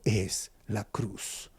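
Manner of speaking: hushed answer. Acted. Preacher to a suffering listener, slow hushed reverence. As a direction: let the cross land slowly, very soft. Sermon climax, answer is the cross.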